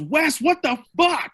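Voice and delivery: startled, calling out to the driver; startled shout. Retold or imitated. Bandmate startled by the bang, shouting at the driver.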